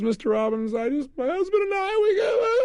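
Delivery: high, wailing imitation of a crying woman; hammed-up tearful high voice; mock crying. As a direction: squeak it out like she's about to sob. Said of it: "and she starts to cry".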